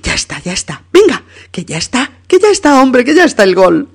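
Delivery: de tranquilo a grito agudo y fuerte; grito de gol. Acted. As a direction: explotar de emoción al final. (Luisa, a punto del infarto, grita el gol cada vez más alto)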